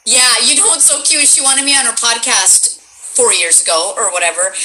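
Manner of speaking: ordinary delivery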